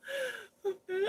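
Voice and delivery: a squeaky high giggle. Real, not acted. Laughing at herself to viewers, high giggle. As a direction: let out a high embarrassed giggle. Embarrassed about her torn Bible.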